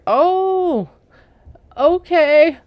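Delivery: high, stunned oh then a slow okay; stunned surprise. Retold or imitated. Retelling her shock at his reply, stunned and high. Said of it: "my mouth dropped open".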